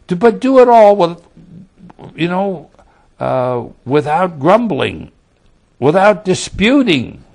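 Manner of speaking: rally the congregation to obey; fiery exhortation. Acted.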